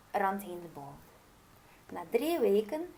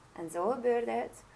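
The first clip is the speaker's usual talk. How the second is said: ordinary delivery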